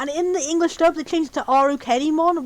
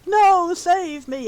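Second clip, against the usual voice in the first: long, drawn-out "no", higher pitched; hammed-up helpless cry for saving; mock plea for rescue; 4 semitones higher, much slower than usual. Vampire villain begging for rescue as evil Digimon take over, mock plea. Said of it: "and he's like".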